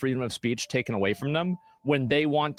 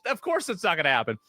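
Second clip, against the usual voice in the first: normal talking voice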